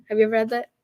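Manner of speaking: ordinary talk, nothing marked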